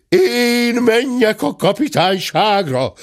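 high, slow howl of protest; outraged howl. A narrator's reading. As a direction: wail it in indignant disbelief. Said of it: "üvöltötte dörmögő úr".

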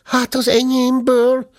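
put-on high whiny child's voice; whining. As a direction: whimper it like a sulky little one. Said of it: "nyafogott Pimpi".

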